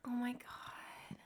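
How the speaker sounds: very soft, slow whisper; stunned whisper. Real, not acted. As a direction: whisper it under your breath, drawn out. Aghast aside at the proposal story.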